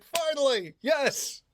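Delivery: high, drawn-out cheer of relief; exultant, high-pitched "at last" delivery; mock elated relief. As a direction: cheer as if it has finally arrived. Mock relief at reaching the action.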